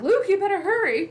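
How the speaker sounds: high, loud mock urgency; urgent call. Han calling to Luke after shooting the intercom, urgent shout. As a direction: call out to hurry someone along.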